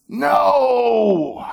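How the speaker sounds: long, high cried-out "no"; insistent, stretched shout of protest; emphatic refusal. Acted. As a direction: wail the refusal out high and drawn. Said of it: "when he insisted emphatically".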